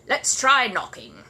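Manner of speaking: slow, loud gruff neighbour. Acted. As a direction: brush it off gruffly. Mr Curry gruff.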